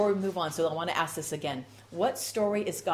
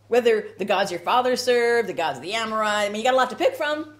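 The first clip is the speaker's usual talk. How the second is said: sarcastic; 8 dB louder than usual. Acted. Sarcastic list of gods.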